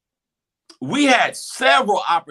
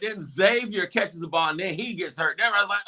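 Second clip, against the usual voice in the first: usual speaking voice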